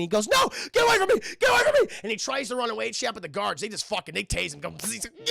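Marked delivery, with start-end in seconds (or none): 0.3-1.9 high, very loud acted panic; frantic mock cries for help; panicked shouting. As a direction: scream it like you're being dragged off. Acted victim fleeing guards.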